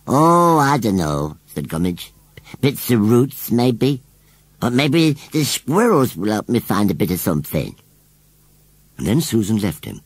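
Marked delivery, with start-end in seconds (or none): 0.1-7.8 rustic scarecrow voice. Acted. Scarecrow explains his family burnings to Susan, plainly. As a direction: chatter on like a simple old scarecrow.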